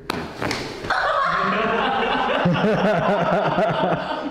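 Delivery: high burst of real laughter; laughing in relief; loud laugh after the crack. Real, not acted. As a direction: let the laugh spill out of you. Patient reacts after the adjustment.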